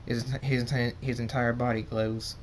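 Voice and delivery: usual speaking voice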